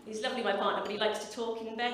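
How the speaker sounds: ordinary delivery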